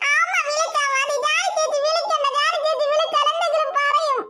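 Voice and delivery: put-on high, half-crying little girl's insistence; whiny child near tears. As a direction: beg mother, almost in tears. (അമ്മയോട് കെഞ്ചുന്ന കുട്ടി, കരച്ചിലിന്റെ വക്കിൽ)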